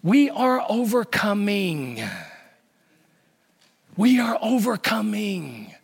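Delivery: slow, firm, stress on "we" and "overcoming"; correcting the slogan: slow weight on "overcoming". Shown as a demonstration. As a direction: lean into "overcoming", slowly. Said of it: "It's not that we are overcomers".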